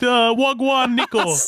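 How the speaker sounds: put-on high, slow Jamaican accent; playful fake Jamaican greeting; Little Jacob impression. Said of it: "Little Jacob".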